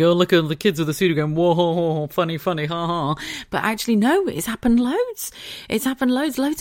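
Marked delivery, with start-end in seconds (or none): 0.0-3.1 mock jolly. Mocking TV-style reaction.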